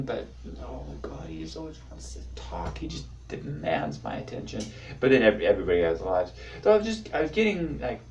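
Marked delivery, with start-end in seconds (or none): 0.0-4.6 hushed, exasperated grumbling; weary mock whisper. Family member complaining about needy brother, hushed exasperation. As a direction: grumble it privately under your breath. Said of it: "even family members still like".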